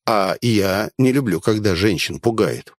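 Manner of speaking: ordinary delivery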